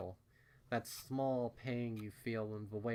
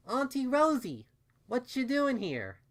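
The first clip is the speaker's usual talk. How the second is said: cheerful little filly; 14 semitones higher, 9 dB louder than usual. A narrator's reading. Dinky greeting Auntie Rosie, high and cheerful. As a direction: ask it like a delighted little kid. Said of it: "Dinky asked cheerfully".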